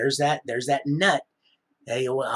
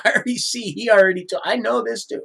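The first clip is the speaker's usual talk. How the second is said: excited outburst; 8 semitones higher, 7 dB louder than usual. Real, not acted. Excited outburst after laugh.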